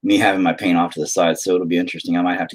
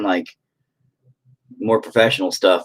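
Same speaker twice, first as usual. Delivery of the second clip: ordinary talk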